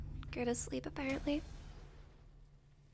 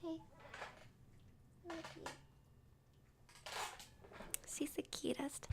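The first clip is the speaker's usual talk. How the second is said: soft whisper to a pet; 5 semitones higher, 17 dB quieter than usual. Real, not acted. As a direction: whisper sweetly to the dog. Cooing quietly at the dog.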